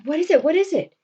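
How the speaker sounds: high, loud alarmed questions; anxious questioning. Retold or imitated. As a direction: imitate a frantic demand for news. Anxious Roxanne demands news, acted.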